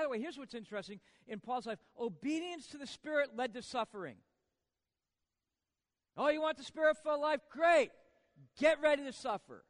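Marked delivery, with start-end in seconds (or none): 6.2-9.6 loud and high, ironic cheer then blunt warning; mock eager retort. Preacher teasing comfort-seekers in the congregation, loud ironic call. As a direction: shout it like a cheery trap being sprung.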